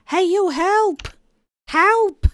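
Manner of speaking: high, loud cry for help; shouting for help. Acted. Gagged captive yelling at a bystander for help. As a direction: yell for someone to save you.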